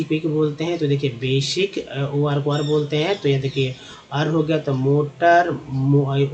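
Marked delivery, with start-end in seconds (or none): none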